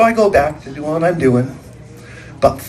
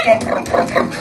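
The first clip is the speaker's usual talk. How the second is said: imitated hoofbeats. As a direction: mimic a pony's galloping hooves. Imitating pony galloping after warden.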